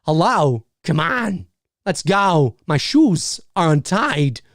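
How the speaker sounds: halting celebrity impression, chopped into short phrases; Christopher Walken. Shown as a demonstration. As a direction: stretch the words with odd breaks between them. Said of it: "I can do a Christopher Walken impression".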